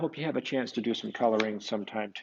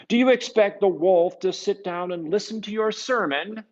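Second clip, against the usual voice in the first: higher, loud, scornful question; mocking Contessa; 9 semitones higher, 9 dB louder than usual. Contessa mocking Francis's plan, loud and scornful. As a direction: scoff at the idea of a sermon. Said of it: "interrupted the Contessa".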